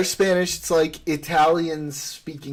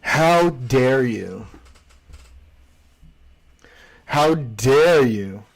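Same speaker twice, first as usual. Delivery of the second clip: mock outrage; 3 semitones lower, 3 dB louder, much slower than usual. Mock outrage over Portuguese.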